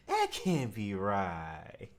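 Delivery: low, slow deadpan; slow disbelief. Real, not acted. Host doubting the guest's story, low slow deadpan. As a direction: drag it out low and flat.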